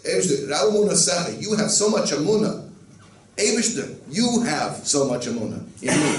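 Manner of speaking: high, loud exclamation of wonder; amazed address to God. Acted. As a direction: call out to God in delighted disbelief, a laugh creeping in. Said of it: "you're saying to the Eibishter".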